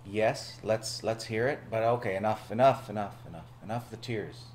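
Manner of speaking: normal talking voice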